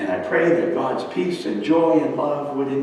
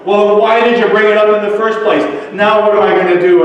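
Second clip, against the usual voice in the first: high, loud angry outburst; upset and angry; 7 semitones higher, 8 dB louder than usual. Acted. Upset Ryan complaining to the old woman, angry and loud. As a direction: lash out in frustrated protest. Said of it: "got more than upset and angry".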